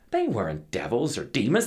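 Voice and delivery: usual speaking voice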